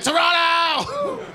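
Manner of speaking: long, high drawn-out shout; scream out loud. Shown as a demonstration. Comedian shows why Toronto is fun to scream, long high shout. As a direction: stretch the city name into a holler. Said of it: "Toronto is the favorite to scream out loud".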